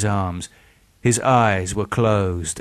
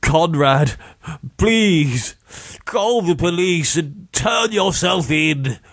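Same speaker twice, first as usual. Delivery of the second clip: desperate pleading; 8 semitones higher than usual. A narrator's reading. Victim begging his attacker Conrad to call the police, desperate pleading. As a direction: plead desperately in a raised pitch.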